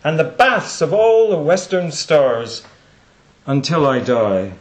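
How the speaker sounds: slow, loud, measured verse with long pauses; poem recitation. Acted. Speaker reciting a poem to the audience, slow declamation. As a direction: declaim the verse in weighty, spaced phrases.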